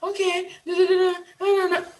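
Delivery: high, hammed-up put-on accent, mocking fake posh speakers; mock fake foreign accent. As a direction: parody someone straining to sound foreign. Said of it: "You don't have to, like".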